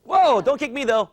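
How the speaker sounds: high, loud urging; calling out. Real, not acted. Coach egging on a kicking child, loud.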